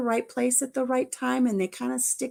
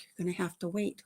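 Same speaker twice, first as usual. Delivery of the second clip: lightly low, soft reminder; low patient refrain; 3 semitones lower, 9 dB quieter than usual. Retold or imitated. Grandmother telling granddaughter to wait, low and patient. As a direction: say it softly, dropping in pitch.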